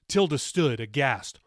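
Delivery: ordinary delivery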